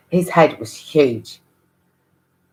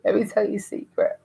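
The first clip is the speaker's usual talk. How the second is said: soft, secretive, slightly higher; teacher's confiding tone; 5 semitones higher, 5 dB quieter than usual. Retold or imitated. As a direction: imitate teacher sharing a secret. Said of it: "That's how she said it".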